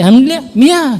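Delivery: put-on Alhamdulillah blurred into a childish "Mia"; imitated toddler mispronunciation. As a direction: mimic a two-year-old attempting "Alhamdulillah, Mia". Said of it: "Tapi nggak jelas, semampunya dia".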